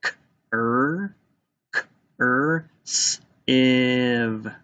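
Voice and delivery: "K, er, s, iv" one sound at a time. Shown as a demonstration. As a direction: tap out "K, er, s, iv", stretching the vowels. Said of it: "you have to tap out".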